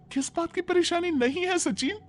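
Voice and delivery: outraged wife protesting how the men treat women; indignant young wife. Acted. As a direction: throw his question back at him, outraged. Devika protests angrily.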